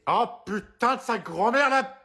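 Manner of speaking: slow, high exasperated curse; furious outburst. Acted. Mother cursing at the game, drawn-out and furious.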